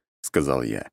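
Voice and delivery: ordinary delivery, nothing marked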